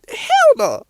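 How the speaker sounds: sudden high, loud "Hell no" yelled out; outraged shout. Real, not acted. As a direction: blast it out in disbelief. Outraged rejection of half-hearted faith.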